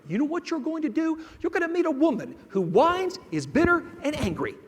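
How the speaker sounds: high-pitched, incredulous motherly lecture; mother's scolding warning. Retold or imitated. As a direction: warn your son in a high, exasperated voice. Mother warns her son sharply.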